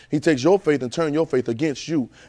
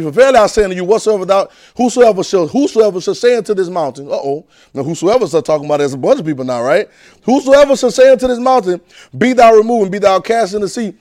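loud, high scripture proclamation; fiery scripture reading; preaching scripture; 6 semitones higher, 9 dB louder than usual. Acted. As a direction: proclaim the verse. Reading scripture at full force.

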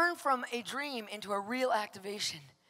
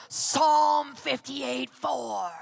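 slow, loud booming call of the verse; retold shout of God; 7 dB louder, much slower than usual. Pastor retells God waking him at four, slow booming shout. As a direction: boom out each word like a voice from heaven. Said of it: "he shouted this at me".

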